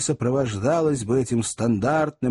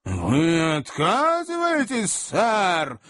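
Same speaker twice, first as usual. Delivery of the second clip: slow, high, incredulous question; affronted and drawing out the words; indignant challenge; 7 semitones higher, much slower than usual. A narrator's reading. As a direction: stretch it out as a threat. Acted quarrel, incredulous challenge.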